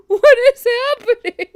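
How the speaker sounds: high, loud laughing squeal; amazed disbelief. Real, not acted. Player baffled by this case's turn, amazed. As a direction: cry out in baffled amusement.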